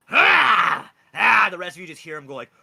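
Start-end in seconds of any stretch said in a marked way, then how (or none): 0.1-1.5 loud high straining roar; dragon roar. Acted. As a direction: roar as he wrenches his legs free. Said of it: "and he goes like".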